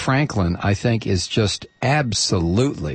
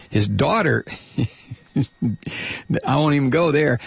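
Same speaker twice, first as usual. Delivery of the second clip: ordinary talk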